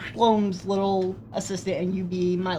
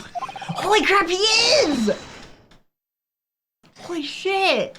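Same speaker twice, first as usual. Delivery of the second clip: higher, slow shocked exclaim; 10 semitones higher, much slower than usual. Real, not acted. Realizing her partner is the killer, shocked. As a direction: gasp it out in disbelief.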